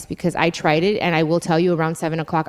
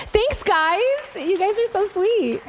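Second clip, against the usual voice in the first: touched thanks; 11 semitones higher than usual. Real, not acted. Host thanking the live audience, high and touched. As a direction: thank them sweetly, voice lifted.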